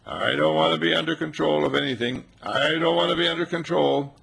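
a hint of an independent cat; lightly voiced cat. Cat refusing to be petted, a hint of haughty cat. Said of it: "she says".

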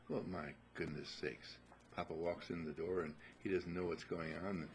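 usual speaking voice

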